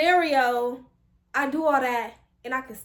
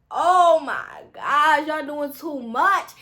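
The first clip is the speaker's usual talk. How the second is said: slow, drawn-out exasperation, starting loud; exasperated complaint; 5 dB louder, much slower than usual. Retold or imitated. Student fed up with proctor's demands, loud dragged-out groan. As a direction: drag out each word, fed up.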